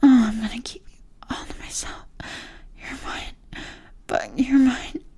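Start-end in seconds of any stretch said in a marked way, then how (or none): none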